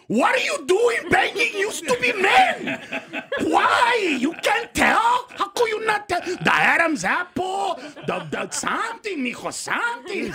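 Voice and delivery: high, loud, incredulous berating; hammed-up outraged father, stretching the words out; scolding dad. Dad berates son once they're alone, loud and exasperated.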